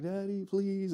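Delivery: soft, drawn-out imitation of a wheedling girl; pleading daughter. Preacher imitating a daughter working on her father, pleading. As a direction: coax gently, mimicking a daughter's plea. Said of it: "daughters ... go up to them and be like".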